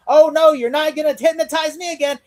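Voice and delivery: mock Bullock. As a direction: play it like a cartoon tough cop refusing. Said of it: "Bullock's like".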